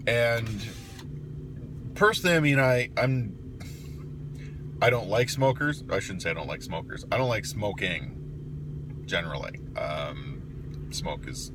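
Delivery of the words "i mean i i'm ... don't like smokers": ordinary talk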